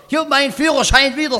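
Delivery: high, bright announcing of good news; delighted boast, high; excited. Acted. Happy friend announces license back.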